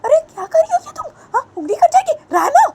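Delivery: very high, loud alarmed squeal; high panicked cartoon voice; shrill panicked voice. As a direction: squeak out a frantic warning. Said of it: "तो बानी बोलती है".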